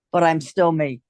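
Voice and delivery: ordinary delivery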